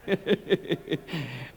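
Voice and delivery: amused chuckle at own joke; short run of chuckles. Real, not acted. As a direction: laugh briefly to yourself.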